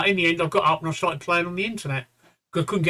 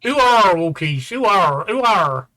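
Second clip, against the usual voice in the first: louder, broad Suffolk yokel voice; Suffolk accent; 3 semitones higher, 7 dB louder, slower than usual. Acted. Man calls the dog in a hammed-up Suffolk accent. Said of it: "I better say it in a Suffolk accent".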